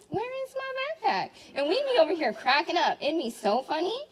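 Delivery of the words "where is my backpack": high, puzzled whine; mock confused classmate. Classmate searching for a hidden backpack, high and puzzled. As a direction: play the lost kid searching. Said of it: "as they were like".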